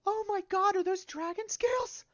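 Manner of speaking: very high, gasping amazement; gasp, then squeal in awe at a rare find; airy falsetto astonishment; astonished shopkeeper. Acted. Shopkeeper reacts to dragon scales.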